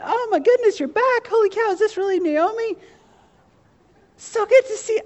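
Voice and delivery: high, squealing welcome-home gush; way up high, overjoyed girly squeal; excited townswomen. Acted. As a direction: gush like thrilled church ladies greeting an old friend. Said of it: "I can't do that with my voice".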